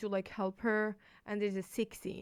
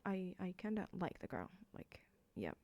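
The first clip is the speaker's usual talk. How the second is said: shy confession; 12 dB quieter than usual. Retold or imitated. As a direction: trail off bashfully. Hero shyly admits his feelings.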